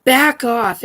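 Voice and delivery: loud, sharp warning; firm loud command; sharp back off. Real, not acted. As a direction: snap it at an unseen presence. Telling off unseen entities.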